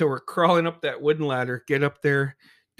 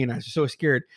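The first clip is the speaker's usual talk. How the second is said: normal talking voice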